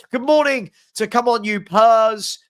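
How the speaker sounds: normal talking voice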